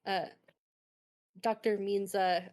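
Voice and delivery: normal talking voice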